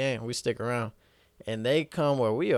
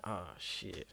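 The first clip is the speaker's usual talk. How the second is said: very soft, under the breath; hushed oh shit; 5 semitones lower, 17 dB quieter than usual. Retold or imitated. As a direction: mutter it in dread. Seeing him in the pizza place.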